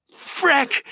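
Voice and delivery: very high pained shriek. Acted. Xander caught in the cords screams in pain, shrieking. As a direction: shriek it in panic. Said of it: "shrieked Xander".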